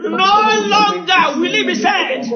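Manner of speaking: loud, high, building prayer declaration; fiery preacher declaring over the congregation; fervent preaching. Real, not acted. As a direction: preach it at full force, rising.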